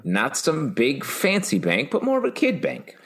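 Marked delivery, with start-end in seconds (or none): 1.9-3.0 put-on high childlike voice; squeaky little kid pitch. Joking about kid banks.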